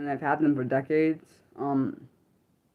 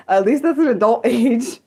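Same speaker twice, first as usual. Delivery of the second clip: laughing; 5 semitones higher, 5 dB louder than usual. Real, not acted. Laughing about looking young.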